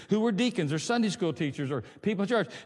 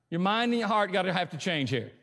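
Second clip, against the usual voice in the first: ordinary delivery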